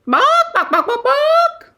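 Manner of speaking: very high, loud yapping with a laugh; yappy bark. Acted. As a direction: yap like a tiny dog, giggling. Dog barks, teller laughs.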